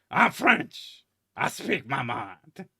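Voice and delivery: loud, high, hammed-up bad French accent; John Cleese French accent. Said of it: "in a John Cleese bad French accent".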